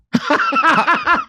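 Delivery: a real laugh breaking out; burst of laughter. Real, not acted. Laughing at his own joke about the moon, high burst.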